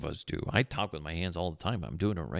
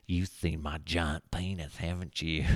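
goofy smug boss; 3 semitones lower, 4 dB quieter than usual. Retold or imitated. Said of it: "giving me this goofy smile".

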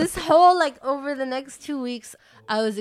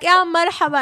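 a bright, higher, louder motherly hello; mom's greeting; 8 semitones higher, 9 dB louder than usual. Retold or imitated. Mom greeting the school staff in Arabic, louder and higher.

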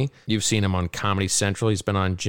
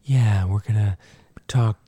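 whispering along; slower than usual. Shown as a demonstration. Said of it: "talk just like this".

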